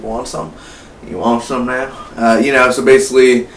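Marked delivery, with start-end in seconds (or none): 0.0-1.9 offering softly. Retold or imitated. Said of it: "He's like".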